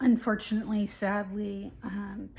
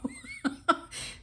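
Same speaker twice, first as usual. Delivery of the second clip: breathy laugh; 6 semitones higher than usual. Real, not acted. Laughing at own sneeze fest.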